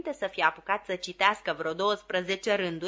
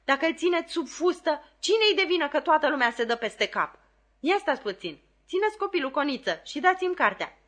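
exasperated scolding; 6 semitones higher, 4 dB louder than usual. A narrator's reading. Flopson scolds her mistress.

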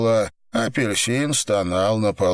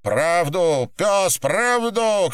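high, drawn-out demand; angry demand; 10 semitones higher, much slower than usual. Acted. Captor interrogates pinned man, angry insistent demand. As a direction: press hard for a confession, raised pitch.

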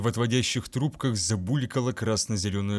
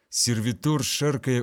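ordinary delivery

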